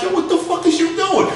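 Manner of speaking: can't believe what he's seeing; high incredulous yell. Retold or imitated. To friend Jose about the drugs, a high stunned demand.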